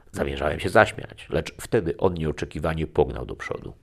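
plain reading voice

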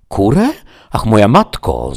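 loud, high cry of disbelief; exclaiming innkeeper. Acted. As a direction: cry out, aghast at the request. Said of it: "zawołał oberżysta".

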